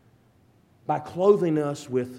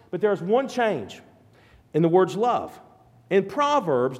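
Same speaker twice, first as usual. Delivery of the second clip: ordinary delivery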